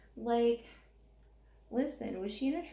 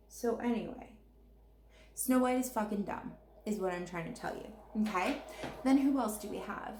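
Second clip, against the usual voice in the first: ordinary talk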